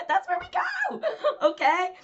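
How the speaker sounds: high-pitched, laughing through the words. Real, not acted. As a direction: giggle the line out. Laughing at her own joke.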